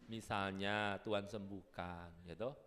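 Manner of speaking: ordinary talk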